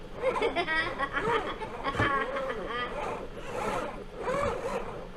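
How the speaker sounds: long, high, soft giggle; tickled robot laughter; giggling laugh. Pretend.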